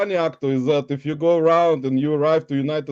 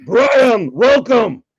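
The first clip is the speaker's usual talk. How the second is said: loud, higher ceremonial welcome; Hungry Shark shout; 5 semitones higher, 7 dB louder, much slower than usual. Acted. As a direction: proclaim it like a mock herald. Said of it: "the Hungry Shark would like to welcome".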